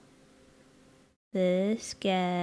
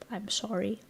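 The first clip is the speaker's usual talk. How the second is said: normal talking voice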